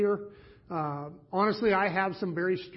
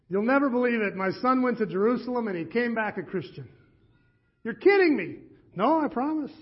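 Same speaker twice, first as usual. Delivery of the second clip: put-on a higher, louder Yiddish-accented telling of the news; a disbelieving Yiddish lilt, higher; 5 semitones higher, 4 dB louder than usual. Two fathers trade news in a Yiddish accent. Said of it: "they begged me not to do the accent".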